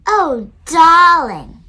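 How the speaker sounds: put-on a boy hamming up fancy manners; posh mock voice. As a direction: play a grand posh lady, pinky up. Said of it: "he says".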